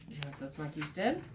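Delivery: ordinary delivery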